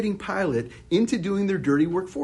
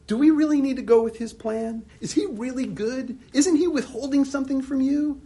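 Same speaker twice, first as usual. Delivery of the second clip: higher, insinuating whisper of doubt; tempting serpent; 6 semitones higher than usual. Retold or imitated. The serpent in the garden tempting people, sly and insinuating. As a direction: coax them into doubting God.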